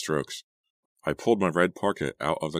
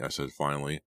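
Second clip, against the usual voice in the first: usual speaking voice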